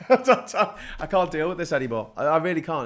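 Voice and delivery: fed up and half laughing; exasperated. Real, not acted. Viewer hears speaker at the end of their tether, exasperated.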